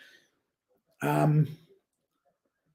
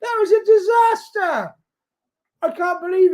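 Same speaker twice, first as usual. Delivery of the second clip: high, loud cry of disbelief; disbelieving shout; 4 dB louder than usual. Real, not acted. Commentator reacts to bad pass.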